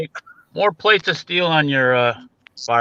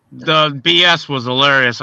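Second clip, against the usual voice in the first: normal talking voice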